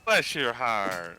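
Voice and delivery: slow, syrupy Southern "bless your heart"; a touch of mock Southern sweetness; Southern drawl. Joking about Southern sweet sarcasm.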